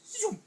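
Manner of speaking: high, loud zoom; quick whooshing cartoon getaway noise; zooming sound effect. Turtles dart into their houses.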